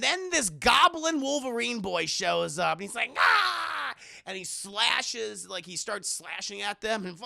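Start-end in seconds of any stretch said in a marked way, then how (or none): 3.2-3.9 high loud battle cry; attack yell. Retold or imitated. Goblin boy slashing at the heroes, loud yell. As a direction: lunge in with a yell.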